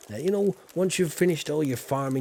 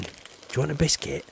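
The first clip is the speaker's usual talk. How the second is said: usual speaking voice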